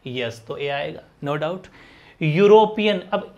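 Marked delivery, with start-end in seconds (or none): none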